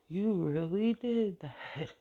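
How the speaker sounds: thanking God through laughter; laughing. Retold or imitated. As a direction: laugh through the words in joy. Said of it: "And I started laughing".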